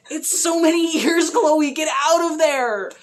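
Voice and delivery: high, loud urgent yell; shouted warning. Real, not acted. Fan shouting at Chloe to leave Dan, high and loud. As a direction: yell at her to get out.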